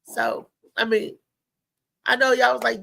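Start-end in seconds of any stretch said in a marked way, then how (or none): none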